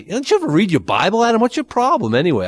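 high-pitched, needling mock challenge; insulting challenge. Preacher acting out an insult to someone's Bible reading, high indignant challenge. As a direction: throw it out like an exasperated put-down.

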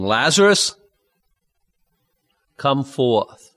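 a mild call of "Lazarus, come forth"; loud cry. Acted. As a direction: call Lazarus out of the tomb with a light raise of the voice. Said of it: "he cried with a loud voice".

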